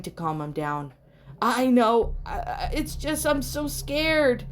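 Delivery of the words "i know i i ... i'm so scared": high, stammering, tearful cry; terrified boy clings to his father, wailing; scared wail. A narrator's reading. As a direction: break into a frightened wail. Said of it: "he wailed".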